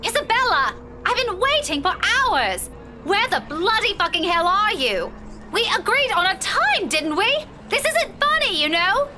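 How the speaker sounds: indignant scolding, pitch leaping high; angry reprimand. Acted. Woman scolding her late friend by phone, indignant. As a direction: tear into a friend who left you waiting. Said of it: "A series of reprimands is already at the tip of my tongue".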